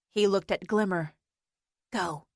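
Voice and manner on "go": whispered. Acted. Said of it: "she whispered".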